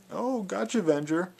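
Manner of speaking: high, loud gloating imitation; passive-aggressive mockery. Speaker imitates a commenter's little passive-aggressive digs, mockingly. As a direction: mimic a petty gotcha in a put-on high voice. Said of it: "these little passive-aggressive".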